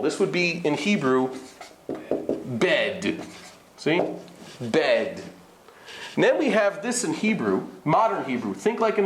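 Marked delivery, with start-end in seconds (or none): 2.5-5.2 "bed" with a drawn open eh; full segol. Shown as a demonstration. Teacher showing Hebrew segol, open-voweled bed. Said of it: "This is a full segol".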